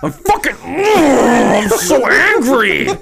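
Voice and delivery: high, loud whiny rage; hammed-up furious wail; mock angry outburst. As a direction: throw a mock tantrum, voice cracking high. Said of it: "you berated that one".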